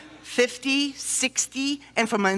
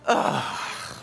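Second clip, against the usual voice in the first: relieved sigh; 11 semitones lower, 4 dB quieter than usual. Retold or imitated. Relief arriving home.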